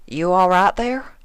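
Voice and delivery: a hint of a drawling farm mare; lightly voiced Applejack, unsure; Applejack, hesitant. A narrator's reading.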